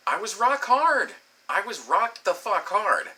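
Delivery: high, drawn-out insistence on "hard"; exasperated emphasis. Retold or imitated. Man insisting to listeners he was ready, exasperated emphasis. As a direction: lean slowly and high on "rock" and "hard". Stressing his frustration in the story.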